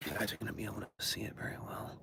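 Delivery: quick whispered aside; fast whisper. Real, not acted.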